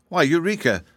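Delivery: high-pitched, mild scolding; reproachful. A narrator's reading. Dorothy rebuking her kitten, reproachful. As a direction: chide a naughty pet. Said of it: "said Dorothy reproachfully".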